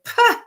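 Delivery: high dismissive scoff; scoffing pah. Retold or imitated. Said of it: "Somebody who would say".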